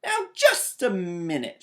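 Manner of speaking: loud indignant shout. A narrator's reading. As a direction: stop her right there, loudly. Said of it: "shouted Sister".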